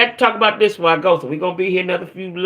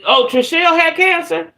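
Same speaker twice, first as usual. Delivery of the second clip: shocked; 12 semitones higher, 4 dB louder than usual. Real, not acted. Sudden shocked question to herself.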